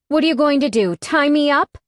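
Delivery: high, loud challenge; angry taunt. A narrator's reading. Heroine daring the bikers to tie her up, loud. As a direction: dare them, furious.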